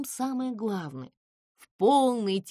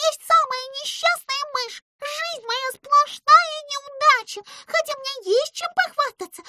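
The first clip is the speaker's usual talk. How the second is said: very high squeaky voice, self-pitying; a tiny mouse begging for help; pleading mouse; 5 dB louder than usual. A narrator's reading. Pimpochka pleads.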